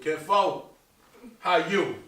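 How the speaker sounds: imitating relative's call. Comedian mimicking a relative calling from down south. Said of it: "I get a call down from down south".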